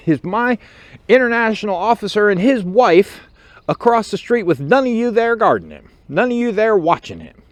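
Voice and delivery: high, loud chewing-out; angry sergeant. Retold or imitated. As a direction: tear into the prospects. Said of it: "he looks at them all and goes".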